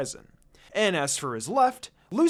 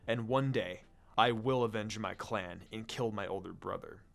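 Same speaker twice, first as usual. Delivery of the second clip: angry but calm; 6 semitones lower, 6 dB quieter than usual. A narrator's reading. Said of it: "I said angrily, but calmly".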